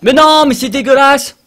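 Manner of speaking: high, loud indignant cry; outraged shout. Real, not acted. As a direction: yell it in outrage. Outraged at game.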